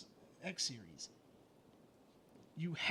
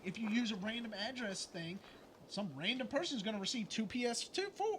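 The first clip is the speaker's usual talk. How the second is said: usual speaking voice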